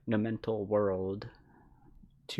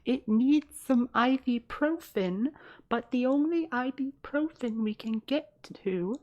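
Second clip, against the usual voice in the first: high-pitched mock frail girl, louder; 5 dB louder than usual. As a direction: parody the pale girl in a squeaky high voice. Mocking the frail girl character.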